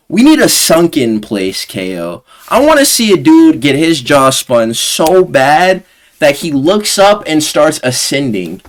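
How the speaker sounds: ordinary delivery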